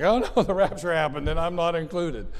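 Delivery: mock panic. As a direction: play a friend panicking that the rapture left them behind, half laughing. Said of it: "they start freaking out".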